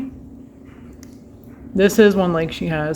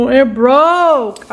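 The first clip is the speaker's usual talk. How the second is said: higher, louder "it broke" in disbelief; dismayed exclaim; 5 semitones higher, 4 dB louder than usual. Real, not acted. Telling viewers the cup broke, dismayed and raised. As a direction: announce the disaster to the camera.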